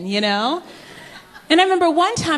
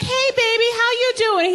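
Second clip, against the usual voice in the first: sweet greeting; 10 semitones higher than usual. Retold or imitated. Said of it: "Next year I'll be like".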